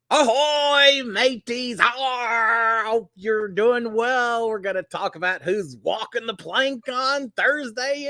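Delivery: high, hammed-up pirate growl. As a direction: play a jolly sea pirate, slowly. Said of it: "in honor of National Talk Like a Pirate Day".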